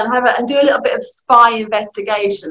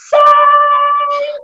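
exasperated; 5 dB louder than usual. Real, not acted. Sibling driving you insane.

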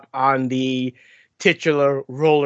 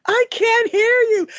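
much higher, deadpan jeer; mock deaf villain. Droopy taunting the tied-up Jessica, high jeer. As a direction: tease her like a villain who won't listen.

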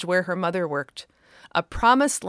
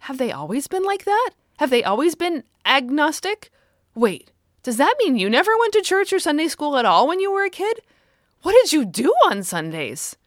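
put-on high, louder child's rapid questions; a child hungry to know more; eager curious girl; 8 semitones higher, 6 dB louder than usual. A narrator's reading. Daughter peppering mother with questions, high and eager.

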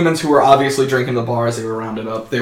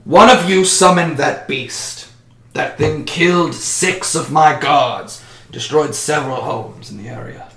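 commanding official; 3 semitones higher, 3 dB louder than usual. Acted. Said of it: "And he says".

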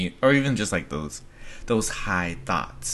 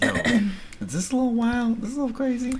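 much higher voice for the imagined friend; mock questioning voice; 13 semitones higher, faster than usual. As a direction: pitch it way up, asking sheepishly. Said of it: "Bounce these ideas like".

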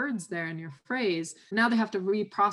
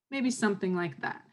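ordinary talk

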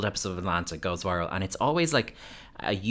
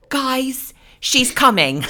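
high, louder urgent warning; mock Dorit alarm; 8 dB louder, slower than usual. Imitating Dorit at the party, high loud mock warning. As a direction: hiss a hammed-up warning to the group. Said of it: "classic of Dorit going".

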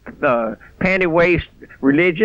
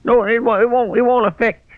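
usual speaking voice

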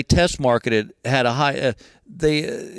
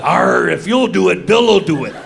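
pirate voice; 4 dB louder than usual. Retold or imitated. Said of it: "But what came out was".

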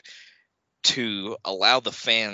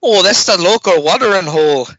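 put-on loud, high, clumsy Irish-ish accent; a wobbly stab at an Irish voice; switching accents clumsily; 10 semitones higher, 13 dB louder than usual. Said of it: "I was going for Irish".

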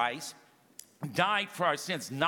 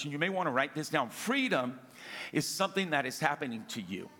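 usual speaking voice

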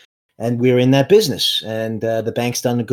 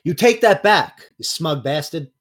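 higher, offended comeback; indignant retort; 4 semitones higher than usual. Acted. Junior rebukes the questioner over his son, indignant. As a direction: start high and affronted, then drop for the insult. Character snaps at an insult.

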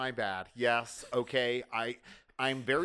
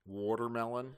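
Baltimore accent; 5 semitones lower, 8 dB quieter than usual. Real, not acted. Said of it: "my Balmer accent".

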